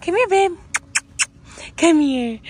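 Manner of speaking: high, loud cooing at a puppy. Real, not acted. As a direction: call the puppy sweetly, rising. Greeting puppy, calling it.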